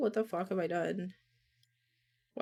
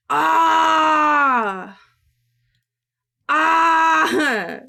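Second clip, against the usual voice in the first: loud high anguished yell; frustrated shout; 8 semitones higher, 11 dB louder than usual. Real, not acted. Streamer yells at broken recording, anguished. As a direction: cry out in real frustration. Frustrated cry over lag.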